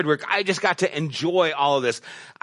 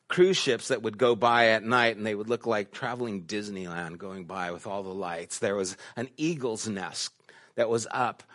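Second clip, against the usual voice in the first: usual speaking voice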